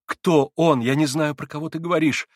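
alarmed protest; frightened babble. A narrator's reading. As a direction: stammer it out in fright. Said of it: "пролепетал Алёша уже в испуге".

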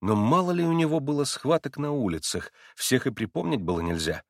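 ordinary delivery

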